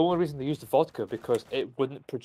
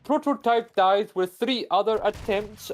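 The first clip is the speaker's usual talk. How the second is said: mock Russian accent slipping into Indian; 9 semitones higher, 3 dB louder than usual. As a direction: play a Soviet official whose Russian accent drifts towards Indian. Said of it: "I'm going Indian now".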